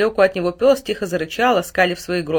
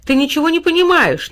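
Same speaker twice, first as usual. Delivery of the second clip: put-on higher, louder childish complaint; sulky protest; 9 semitones higher, 7 dB louder than usual. A narrator's reading. Boy stamping his foot at his mother, sulky. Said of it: "недовольно топнул ногой Тильтиль".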